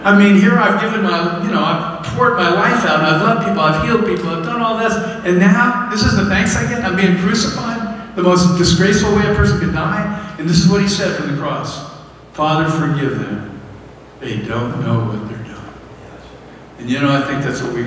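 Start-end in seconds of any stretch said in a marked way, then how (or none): 0.0-10.3 higher-pitched, aggrieved listing of good deeds; acted indignation at rank ingratitude; indignant. Imagines being indignant on the cross. 12.3-15.7 slow, lowered, tender plea; imitating a calm, merciful prayer, softening at the end; gentle forgiveness. Quotes Jesus forgiving from the cross.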